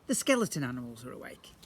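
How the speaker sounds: ordinary delivery, nothing marked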